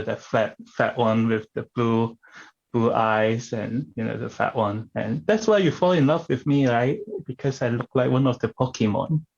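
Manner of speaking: ordinary delivery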